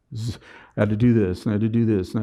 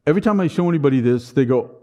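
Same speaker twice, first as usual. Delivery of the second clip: ordinary delivery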